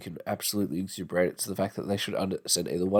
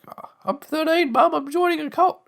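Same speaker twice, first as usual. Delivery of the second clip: put-on very high squeaky kid voice; mock teen. Thirteen-year-old telling mum about a cult, squeaky mock voice. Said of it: "Just be like".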